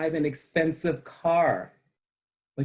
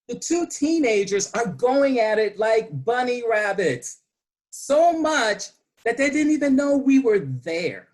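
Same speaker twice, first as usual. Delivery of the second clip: excited; 6 semitones higher, 4 dB louder than usual. Real, not acted. Shocked telling of walk-in.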